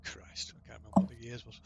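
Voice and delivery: quick whispered aside. Real, not acted. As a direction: whisper it under your breath. Aside to players.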